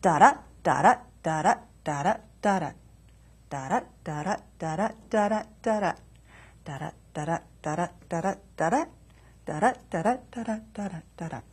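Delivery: each "Da-da" unstressed then stressed, in steady pulses; iambic rhythm. Shown as a demonstration. Poet demonstrating iambic pentameter, even rhythmic chant of "Da-da". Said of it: "it'll sound something like this".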